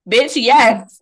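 loud, high, flat-out yes; blunt retort. Retold or imitated. Answering her sister's question, loud and blunt. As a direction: slam it home, incredulous. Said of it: "I'm like".

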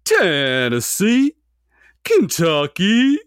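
high, drawn-out football play call; stretched-out, rising comic yell; Bill Murray impression. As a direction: yell it out slowly like a quarterback's call. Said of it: "a good Bill Murray impression".

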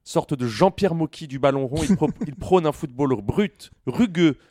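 written tribute read out theatrically; present a co-host with mock grandeur. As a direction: build a teasing portrait, phrase by phrase. Lit l'intro écrite pour son coéquipier. (présentation déclamée, pompeuse et taquine)

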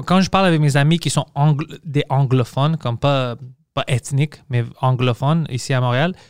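ordinary talk

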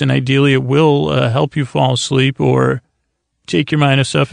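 ordinary talk, nothing marked

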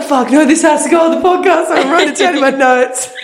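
high, squealing voice breaking into laughter; hysterical laughing. Real, not acted. Telling friend about her podcast notes, hysterically laughing. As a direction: struggle to talk through real laughter.

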